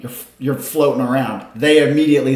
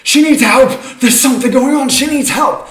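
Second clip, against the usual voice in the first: high-pitched, urgent cry for help; alarmed crowd member raising the alarm; calling for help; 12 semitones higher, 7 dB louder than usual. Acted. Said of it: "a number of them start calling".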